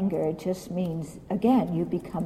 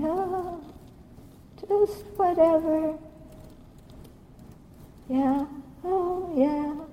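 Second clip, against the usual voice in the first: mock shrug; 6 semitones higher than usual. Mocking a careless attitude.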